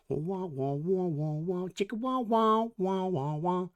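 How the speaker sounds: sung wah-wah guitar riff, high and bouncy; porn music wah-wahs. Pretend. Tarot reader jokingly scoring the King of Cups with wah-wah music. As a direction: hum a cheesy wah-wah funk line. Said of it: "That was a terrible porn reference".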